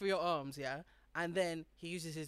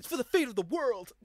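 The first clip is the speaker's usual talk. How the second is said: epic movie line; 5 semitones higher, 9 dB louder than usual. Retold or imitated. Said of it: "And it's".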